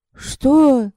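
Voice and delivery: startled, high questioning yelp; taken aback. A narrator's reading. Evdokia reacts to being told to change her face, taken aback. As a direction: ask it in high-pitched puzzlement.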